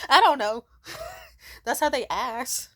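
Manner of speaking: laughing loudly through the words. Real, not acted. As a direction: giggle it out, embarrassed. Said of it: "Why'd I have to say the blackies?".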